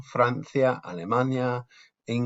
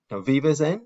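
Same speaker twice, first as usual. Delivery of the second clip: vives en lifted higher at the end as a question; rising question tune; 5 semitones higher, 6 dB louder than usual. Shown as a demonstration. Teacher demos a question by raising the voice. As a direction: let vives en climb like you're asking. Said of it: "we raise our voice to become do you".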